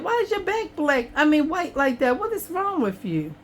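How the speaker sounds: high, sing-song voice to the cat; pet talk. Real, not acted. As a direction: coo at the cat, puzzled. Talking to her cat.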